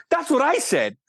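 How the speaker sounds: high, exasperated-sounding agreement; retold emphatic agreement. Director agreeing with the star about the trailer, emphatic. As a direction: replay it pitched well up, as vindication.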